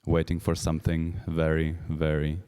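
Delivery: slow, soft, suspenseful announcer; hushed dramatic build-up. Acted. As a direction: draw out the tension quietly.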